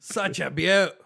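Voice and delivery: hammy Croc Hunter Aussie; Australian accent. Retold or imitated. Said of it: "worst Australian accent".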